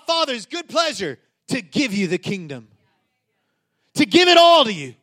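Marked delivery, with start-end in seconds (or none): 3.9-4.9 high, loud declaration; emphatic preaching. Real, not acted. Preacher proclaims inheritance to congregation, loud and high. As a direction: ring it out to the room.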